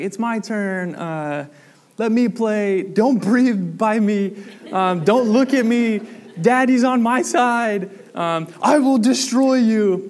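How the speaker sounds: put-on high, loud, drawn-out whiny kid; bratty sibling fight. Kid yelling at brother over the video games, whiny and loud. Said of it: "like, the normal arguments".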